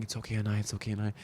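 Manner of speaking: soft, hushed reassurance; gentle reassurance. Retold or imitated. Carl calming Anaya during her fight with Casey, softly soothing. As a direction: hush it like calming a friend mid-fight. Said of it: "Carl was saying".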